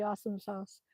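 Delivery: ordinary talk, nothing marked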